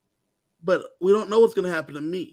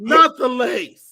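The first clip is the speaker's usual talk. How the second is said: mock plea; 4 semitones higher, 7 dB louder, much slower than usual. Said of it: "joking plea over her lace".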